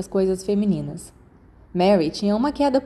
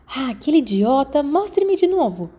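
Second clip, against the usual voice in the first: high and eager, slowing on the demand; thrilled, insisting to see it once more; excited; 5 semitones higher than usual. A narrator's reading. Lily thrilled by the spell.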